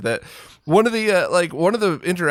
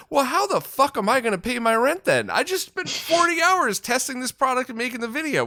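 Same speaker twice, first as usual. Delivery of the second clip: higher-pitched exasperated comeback; indignant retort; 8 semitones higher than usual. Acted. Creator answers commenters who want free videos, indignant. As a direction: argue back, incredulous and wound up.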